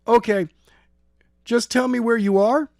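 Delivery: high, loud then breathy urgent plea. A narrator's reading. Skylar urging the girl to say where she is, high. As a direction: press her, tense and urgent.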